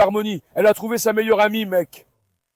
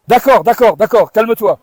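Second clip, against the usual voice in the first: louder soothing to excited dogs; loud calming; 6 dB louder than usual. Real, not acted. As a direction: settle them, firm and loud. (calmer les chiens d'une voix forte)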